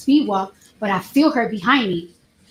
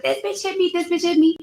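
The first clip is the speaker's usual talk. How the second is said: mock freak-out; 7 semitones higher than usual. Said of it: "she just started wilding out".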